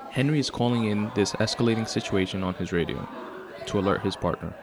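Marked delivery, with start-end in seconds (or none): none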